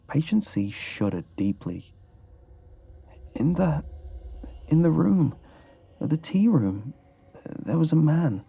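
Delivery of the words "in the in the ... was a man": high, halting, frightened recollection; shaken. A narrator's reading. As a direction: falter through a frightening memory in a raised pitch. Shaken dreamer recounts the nightmare.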